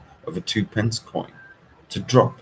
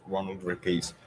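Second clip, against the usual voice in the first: ordinary delivery, nothing marked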